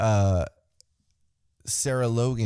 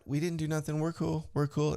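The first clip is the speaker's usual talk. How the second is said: mock innocent; 6 semitones higher, 5 dB quieter than usual. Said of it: "they're like".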